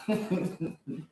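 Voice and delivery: a short soft laugh; amused little laugh. Real, not acted. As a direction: let a quiet laugh slip. Laughing at stereotype joke.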